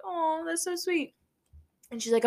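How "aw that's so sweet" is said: higher, slow, cooing "aw" of being touched; retold tender coo; touched aww. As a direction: melt a little at the kindness. Touched by tattoo artist's offer.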